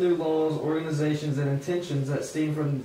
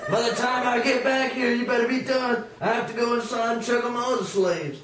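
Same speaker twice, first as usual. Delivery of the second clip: high master voice with a laugh; a cocky high command, laughing; master's orders; 6 semitones higher than usual. Acted. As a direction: master warning the worker before going inside, high and laughing. Master giving orders, leaving.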